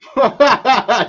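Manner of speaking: high burst of laughter; sudden delighted guffaw; loud laugh. Real, not acted. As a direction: crack up out loud.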